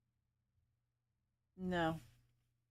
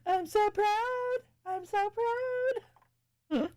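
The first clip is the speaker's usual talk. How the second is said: high, slow laughing pride; giggling through the words; giggly; 11 semitones higher than usual. Real, not acted. Laughing, self-mocking pride.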